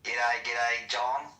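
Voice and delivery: lightly put-on Australian accent; Australian accent, a mild put-on; mock Aussie greeting. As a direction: greet him like an Aussie bloke.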